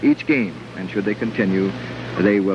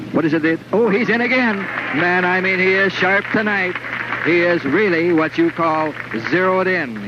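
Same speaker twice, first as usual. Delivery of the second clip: high, thrilled strike call; excited play-by-play; 7 semitones higher than usual. Real, not acted. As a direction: shout the strike with delight.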